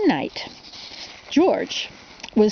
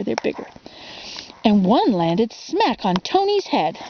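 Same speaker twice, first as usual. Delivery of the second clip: ordinary delivery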